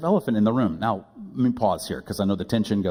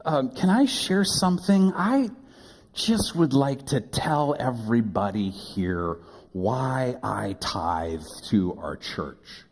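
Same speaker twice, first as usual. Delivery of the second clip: earnest request; slower than usual. Retold or imitated. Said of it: "He said".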